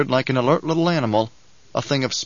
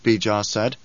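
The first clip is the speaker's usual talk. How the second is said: plain reading voice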